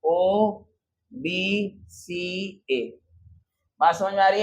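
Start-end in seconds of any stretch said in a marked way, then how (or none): 0.0-1.8 each letter drawn out very slowly; slow letter-by-letter naming; spelled out slowly. Shown as a demonstration. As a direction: read the letters as you write them. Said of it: "major sector क्या हो गया?".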